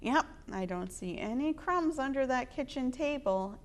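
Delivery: ordinary delivery, nothing marked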